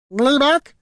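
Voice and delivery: high, loud questioning squawk; creature squawk. A narrator's reading. Creature chiming into the heroes' quarrel, squawking.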